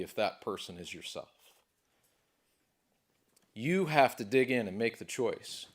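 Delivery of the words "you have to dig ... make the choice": building from firm to loud and high; rising to a shout. Real, not acted. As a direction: push it harder and louder until it breaks open. Speaker building to climax.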